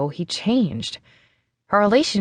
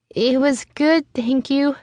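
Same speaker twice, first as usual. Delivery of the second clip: higher and slower, nervous; a little louder; 7 semitones higher, slower than usual. A narrator's reading. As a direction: say it up a bit, forced brightness. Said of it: "I repeated a little louder".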